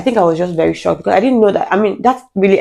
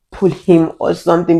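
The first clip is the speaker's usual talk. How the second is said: ordinary talk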